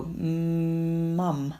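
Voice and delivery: "Mm" held long before "mum", like a stuck start; a drawn-out "Mm" sliding into "mum"; stuttered prolongation. Shown as a demonstration. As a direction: stretch the opening "Mm" of "mum" as a stutter demo. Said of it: "so I would go".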